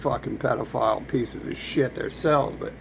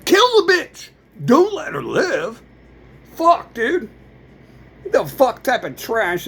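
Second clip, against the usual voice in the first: much higher, louder enraged yell; furious shout; 9 semitones higher, 5 dB louder than usual. Real, not acted. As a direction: explode with rage. Furious outburst at an absent father.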